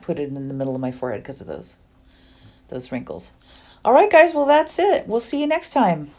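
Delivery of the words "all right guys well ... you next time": much higher and louder goodbye; bubbly high bye to the viewers; cheery sign-off. Real, not acted. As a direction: sing out the goodbye to your viewers.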